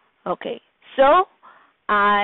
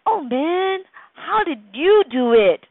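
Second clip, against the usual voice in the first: amazed; 6 semitones higher than usual. Acted. Character asks in amazement.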